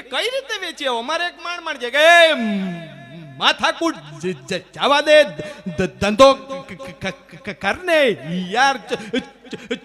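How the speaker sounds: high, loud stammering voice breaking into laughter; stuttering man. Acted.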